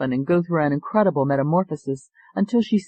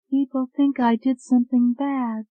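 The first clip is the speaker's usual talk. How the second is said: put-on distant, hollow, higher young voice; 10 semitones higher than usual. Hypnotized woman answering the doctor, hollow and distant. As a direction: sound far away, as if in a trance. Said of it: "Her voice sounded hollow".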